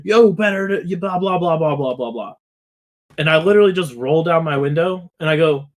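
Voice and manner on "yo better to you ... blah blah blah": mock angry yell, higher, trailing off into blah blah; imitating an angry homeowner yelling, fading out; retold yelling. Angry homeowner yelling at the delivery driver, mock angry yell. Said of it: "starts yelling at me".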